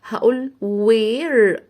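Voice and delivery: very slow "where" with no h; slow demo. Shown as a demonstration. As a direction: stretch "where", keep the h silent. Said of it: "مش هنطق ال H".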